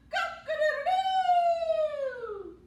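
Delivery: high loud slow crowing; rooster crow. Acted. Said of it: "the rooster said".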